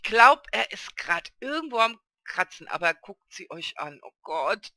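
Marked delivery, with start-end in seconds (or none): none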